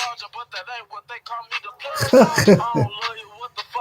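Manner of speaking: a high, relieved chuckle; relieved laugh. A narrator's reading. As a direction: laugh as if let off the hook.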